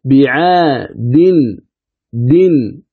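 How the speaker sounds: "بعاد" slowly broken up as bi-'aa, din; tanween spelled out. Shown as a demonstration. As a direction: isolate the final "دن" syllable. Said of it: "فيها تنوين".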